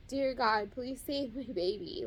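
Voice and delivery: ordinary delivery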